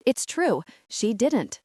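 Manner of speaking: usual speaking voice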